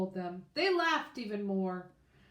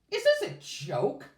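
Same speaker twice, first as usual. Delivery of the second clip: loud, higher angry demand; 7 semitones higher, 8 dB louder than usual. Acted. James confronting the kids who stood up to him, loud demand. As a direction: challenge them hard, disbelieving. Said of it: "James demanded".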